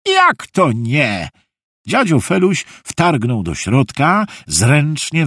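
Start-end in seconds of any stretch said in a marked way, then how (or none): 0.1-1.3 mildly indignant, slow comeback; indignant grandpa. A narrator's reading. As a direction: challenge the refusal, stretching the words. Grandpa objects to the refusal. (lekko oburzony dziadek, powoli)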